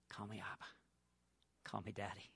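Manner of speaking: very soft, low loving voice; a father quietly invites his son; tender hush. Acted. God speaking tenderly to son.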